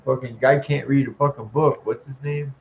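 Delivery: usual speaking voice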